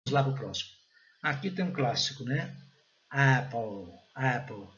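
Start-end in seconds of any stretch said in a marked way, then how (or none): 3.1-4.7 "Apple" opening on a blend of E and A; E-A blended vowel. Shown as a demonstration. Teacher models the word, careful pronunciation. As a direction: open the first vowel of "Apple" between E and A. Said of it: "Esse E com A".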